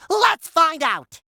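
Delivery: high, drawn-out excited shout; rousing kickoff. Acted. Announcer sends the player into the level, rousing kickoff. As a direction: launch it slowly and big.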